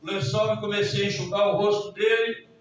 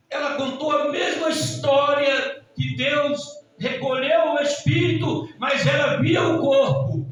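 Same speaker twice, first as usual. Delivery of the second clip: raised, insistent preacher's voice; full-force preaching; 4 semitones higher, 7 dB louder than usual. Real, not acted. Preacher warning the church with God's message, forceful.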